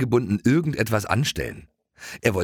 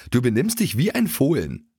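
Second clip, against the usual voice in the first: higher, lightly voiced pony teasing his friend; pony voice, higher and mildly scolding; 7 semitones higher than usual. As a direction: tease him like a know-it-all pony. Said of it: "stöhnte Keks".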